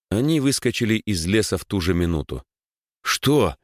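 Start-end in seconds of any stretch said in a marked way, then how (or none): 3.0-3.6 shout. Acted. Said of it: "крикнул Егор".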